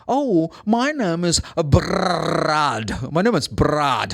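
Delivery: put-on Scottish accent with a long rolled R in "Brad". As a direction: imitate a Scot, trilling the R of "Brad" hard. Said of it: "this R sound for Scottish people, normally it's, like, very strong".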